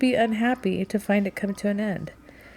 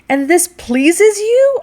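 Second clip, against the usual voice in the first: very high, loud and drawn out; horrified question; 12 semitones higher, 10 dB louder, much slower than usual. A narrator's reading. Rachel asks whether being masculine pleases the captain, horrified. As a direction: climb into appalled disbelief. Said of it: "I asked in horror".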